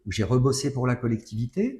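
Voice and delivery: ordinary talk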